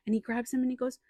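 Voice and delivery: normal talking voice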